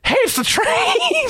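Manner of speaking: high, drawn-out delighted cry; excited discovery. Acted. Character spotting the train on the phone, excited. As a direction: squeal it out in character, stretched and very high.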